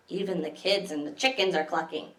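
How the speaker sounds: usual speaking voice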